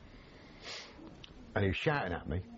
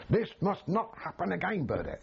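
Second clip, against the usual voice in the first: staged shout, higher in pitch; mock telling-off; 8 semitones higher than usual. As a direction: put on a bark for the listener next door. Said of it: "I've got to shout at you".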